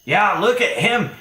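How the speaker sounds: high, loud goblin chiming in; squawky, hammed-up agreement; goblin agreeing. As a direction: pipe up eagerly as a goblin henchman. Game master voices agreeing goblin.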